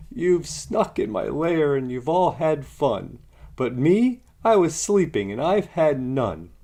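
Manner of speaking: high, loud whiny bear complaint; whimpering bear. A narrator's reading. Bear woken up complains to the party guests, whining loud and high. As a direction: wail like a hurt, sulking bear. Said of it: "he whimpers and he moans".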